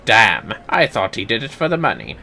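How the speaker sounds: ordinary delivery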